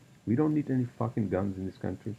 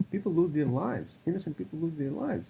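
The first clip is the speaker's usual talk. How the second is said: ranting; 7 semitones higher than usual. Real, not acted. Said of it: "sorry, I'm just ranting".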